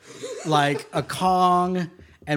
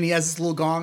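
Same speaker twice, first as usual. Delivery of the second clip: ordinary talk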